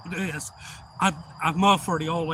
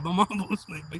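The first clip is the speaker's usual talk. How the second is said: ordinary talk, nothing marked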